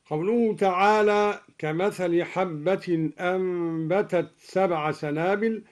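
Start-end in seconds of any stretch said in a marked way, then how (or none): none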